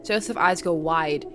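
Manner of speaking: ordinary talk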